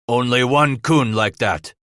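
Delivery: restrained roar in character. A narrator's reading. Old German recognizing the description, roaring. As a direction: boom it out as the old trapper. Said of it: "Frabe roared".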